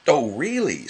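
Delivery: irritated snap at Tigger; snapped. A narrator's reading. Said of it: "snapped Rabbit".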